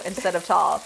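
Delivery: normal talking voice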